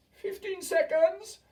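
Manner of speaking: very high, loud, slow witch shriek; crying out. A narrator's reading. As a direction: stretch the count out gleefully.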